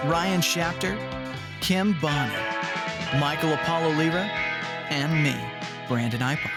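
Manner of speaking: usual speaking voice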